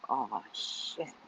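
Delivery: a soft, low "Oh, shit" under the breath; dismayed hush. Retold or imitated. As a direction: quietly realise you're caught out. Realizing sister's prediction.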